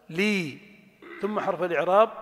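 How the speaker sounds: usual speaking voice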